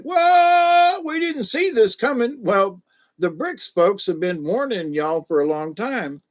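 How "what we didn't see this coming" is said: high, loud, startled gasp of a question; mock shock. Imitating folks freaking out, a high shocked squeal. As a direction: play the panicked Americans caught off guard. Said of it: "They're like".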